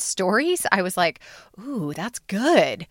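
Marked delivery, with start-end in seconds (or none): 1.6-2.9 slow, drawn-out impressed "ooh"; impressed reaction. Retold or imitated. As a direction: let the admiration stretch out, imitating your own reaction. Recalls reaction to everyone's stories.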